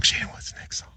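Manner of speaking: imitated whisper, very soft; whispered echo. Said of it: "and then whisper it".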